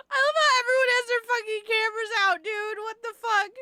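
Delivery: loud, high, laughing disbelief; incredulous outburst. Real, not acted. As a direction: yell it in shocked amusement. Outraged at filming bystanders.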